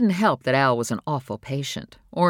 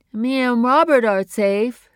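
higher, slow, sulky Phoebe, louder; Phoebe pouting; 9 semitones higher, 8 dB louder, slower than usual. Acted. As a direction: insist like a stubborn young woman. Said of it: "as it did when she got angry".

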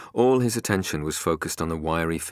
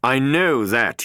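higher, louder, slow scorn; angry retort; 10 semitones higher, 9 dB louder, slower than usual. A narrator's reading. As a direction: cut him down. Harper snaps at Klein.

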